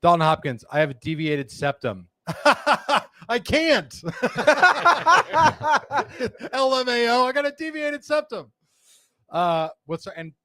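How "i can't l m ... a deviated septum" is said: high-pitched laughter through the words; reading while cracking up; laughing. Real, not acted.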